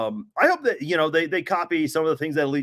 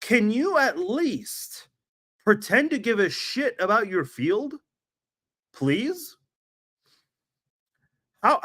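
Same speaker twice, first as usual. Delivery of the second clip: exasperated rant; 5 semitones higher than usual. Real, not acted. Ranting at the Vegas field.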